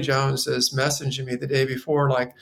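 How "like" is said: ordinary talk, nothing marked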